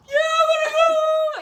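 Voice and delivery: very high, loud, drawn-out bawl; hammed-up boo-hooing; mock crying wail. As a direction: wail it like a homesick recruit. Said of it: "that's the first time I cried".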